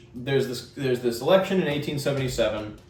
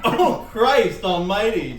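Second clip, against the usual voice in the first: slow pained exclamation; pained cry; 5 semitones higher, 5 dB louder, slower than usual. Real, not acted. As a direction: groan it out, hurting. Spicy wing pain again.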